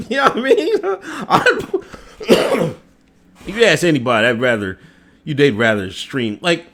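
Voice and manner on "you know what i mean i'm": laughing. Real, not acted. Laughs at own point.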